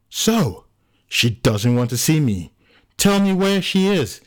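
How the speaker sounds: high-pitched, insistent pleading; agitated demand. Acted. John demands Anno say where she is, agitated. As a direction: push urgently, voice leaping up.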